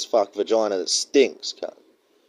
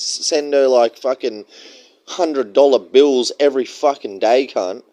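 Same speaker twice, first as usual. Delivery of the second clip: ordinary delivery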